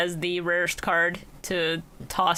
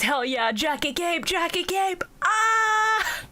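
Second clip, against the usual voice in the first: high, climbing hype chant; excited fan cheer; 10 semitones higher, much slower than usual. Retold or imitated. Reading own notes on the Jacket Cape, high fan excitement. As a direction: cheer upward into a high squeal.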